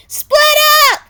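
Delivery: very high, loud command; shouting. A narrator's reading. Jake shouting at his brothers to scatter. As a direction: bark the order to scatter. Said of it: "Jake shouted".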